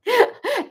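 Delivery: very high, short real laugh. Real, not acted. Host cracking up at her own line, high short laugh. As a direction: squeal a quick laugh out.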